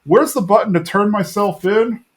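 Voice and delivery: mock panic, high and hurried after a gasp; Hank. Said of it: "He's like".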